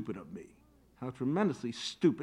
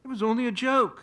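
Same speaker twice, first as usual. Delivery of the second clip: pleading; 8 semitones higher, 5 dB louder, faster than usual. Acted. Wife pleads for the son.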